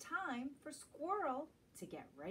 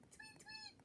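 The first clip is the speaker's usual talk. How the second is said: very high, soft tweets; high, light bird tweet; chirping bird; 14 dB quieter than usual. Acted. Bird voice in picture book.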